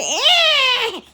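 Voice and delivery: high, loud alien squawk; long hammed-up screech held on one note; imitated Conehead shriek. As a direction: mimic a piercing movie-alien noise. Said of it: "Remember on cone heads, she said".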